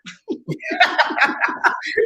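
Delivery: airy squeal of a laugh; breathy high laugh. Real, not acted. Laughing at her own joke about jealous men, breathy high laugh.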